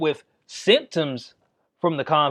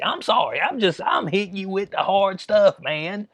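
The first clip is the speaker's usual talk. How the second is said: ordinary delivery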